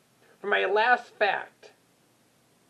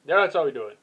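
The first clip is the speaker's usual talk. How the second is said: normal talking voice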